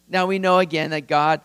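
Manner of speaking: ordinary talk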